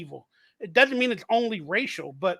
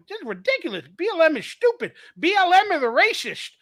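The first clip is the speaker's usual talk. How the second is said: heated retort; 6 semitones higher, 3 dB louder than usual. Retold or imitated. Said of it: "I'm like".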